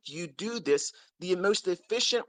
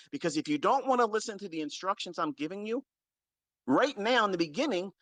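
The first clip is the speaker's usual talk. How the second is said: ordinary talk, nothing marked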